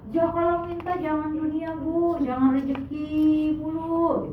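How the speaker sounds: ustadz giving a sermon. Retold or imitated. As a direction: lecture them like an ustadz. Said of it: "kayak tadi ceramah ustadz".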